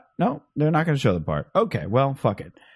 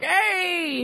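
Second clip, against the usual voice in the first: high cheering "Hey!"; high celebratory whoop of "Hey!"; generic cheer. Retold or imitated. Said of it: "doing a generic".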